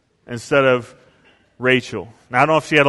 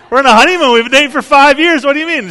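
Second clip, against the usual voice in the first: much higher and louder, exasperated disbelief; incredulous shout; 10 semitones higher, 9 dB louder, faster than usual. Retold or imitated. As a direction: protest loudly, stunned she'd think that. Husband protests wife's fear.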